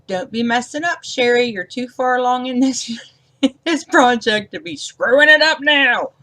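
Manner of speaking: high, loud teasing scold to herself; mock self-scolding. To herself after messing up a card, mock scolding. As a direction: wag a finger at yourself, half laughing. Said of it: "I'm like".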